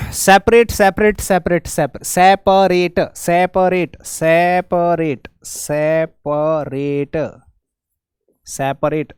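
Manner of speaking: सेपरेट drawn out slowly, "sep-a-rate"; slow teacher's drill of सेपरेट; careful pronunciation. Shown as a demonstration. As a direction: stretch सेपरेट syllable by syllable, slowly. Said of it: "सही है".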